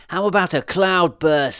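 high and loud, daring; excited witch voice. Acted. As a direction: tempt her with a wild idea. Witch proposes biggest rain.

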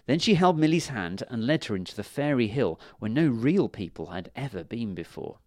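ordinary delivery

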